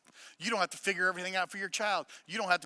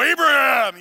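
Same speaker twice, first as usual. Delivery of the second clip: loud, booming call of the name; booming angel voice; 7 semitones higher, 15 dB louder than usual. Retold or imitated. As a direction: boom it out like a mighty angel. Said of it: "if it was a booming voice".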